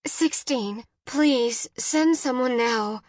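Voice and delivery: high, urgent plea; frantic mother pleading; begging. A narrator's reading. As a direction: beg desperately for help. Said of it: "Jen begged".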